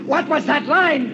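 high, loud, drawn-out cry; frantic demand. Acted. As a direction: cry it out slowly, desperate for the answer. Obsessed man repeats demand, frantic.

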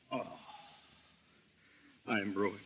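low, soft despair; defeated. Acted. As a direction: collapse into despair.

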